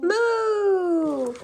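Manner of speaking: high, loud playful cow moo; mock moo. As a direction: moo for the child, hammed-up. Mom imitating cow for toddler.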